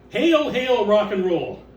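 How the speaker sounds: a grand rallying cry; proclaiming loudly. Acted. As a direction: announce it like a rock anthem. Dramatic opening of exhibition text.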